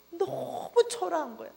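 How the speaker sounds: normal talking voice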